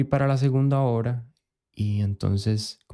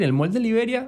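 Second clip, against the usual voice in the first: pitched way up, putting on airs; mock snooty quote; 10 semitones higher than usual. Mocking how people name the Liberia mall, high and affected. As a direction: say the mall's name like a show-off. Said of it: "vos puedes decir".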